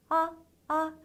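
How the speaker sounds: short, light 아, 아; neutral tone. Shown as a demonstration. Teacher showing neutral tone, short toneless 아. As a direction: drop 아 out briefly with no contour. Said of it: "성조가 없는 음인데요".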